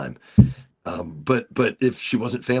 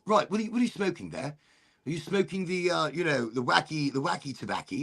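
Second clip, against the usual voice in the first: sly British cop; 5 semitones higher than usual. Retold or imitated. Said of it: "He goes".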